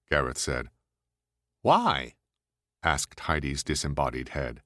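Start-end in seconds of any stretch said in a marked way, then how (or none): 1.6-2.2 brash woman voice. Acted. Heidi's head asks.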